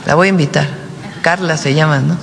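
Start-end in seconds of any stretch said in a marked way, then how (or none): none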